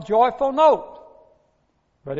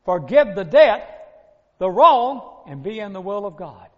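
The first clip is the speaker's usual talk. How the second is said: forceful preaching; 6 dB louder than usual. Real, not acted. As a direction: drive the command home to the congregation. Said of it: "here's the way it is".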